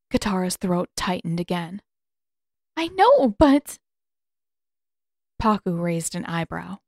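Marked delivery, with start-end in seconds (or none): 2.8-3.8 choked-up protest. Acted. Katara's throat tightens, near tears.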